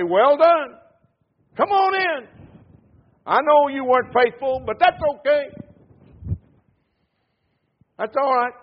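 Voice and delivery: high, loud, breezy mock pardon; mock lenient God. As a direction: parody a careless, easygoing Lord. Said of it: "You think the Lord's going to talk like that?".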